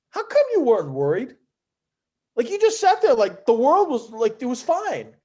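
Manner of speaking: high-pitched imitated bafflement; incredulous questioning. Player asking why he never worried, incredulous.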